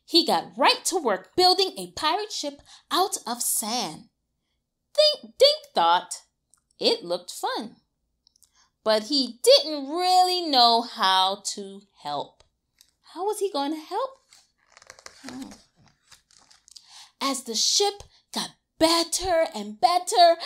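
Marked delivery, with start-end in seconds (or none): none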